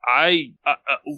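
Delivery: usual speaking voice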